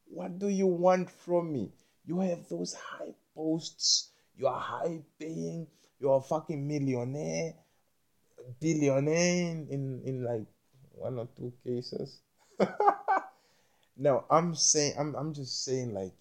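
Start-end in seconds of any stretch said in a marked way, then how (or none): 0.1-12.2 higher, slow incredulous complaint; hammed-up exasperation at the rich; drawn-out higher pleading rant; mock exasperated demand. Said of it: "I'm like". 12.6-13.3 sudden high burst of laughter; squealing laugh. Real, not acted. Laughs at own rant.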